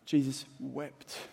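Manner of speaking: slow, Jesus stretched, wept hushed; slow solemn. Acted. Preacher repeating the verse, slow and solemn. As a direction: give each word its weight.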